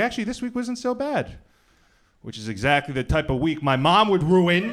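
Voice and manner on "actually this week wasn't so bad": much higher, chirpy self-quote; upbeat quote. Retold or imitated. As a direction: lift it up high and bright. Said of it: "You're like".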